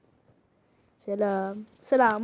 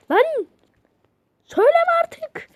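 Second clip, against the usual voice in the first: high, loud snap to stop; angry shout; 6 dB louder than usual. Real, not acted. Yelling at the friend to stop saying it. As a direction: shout him down, really fed up.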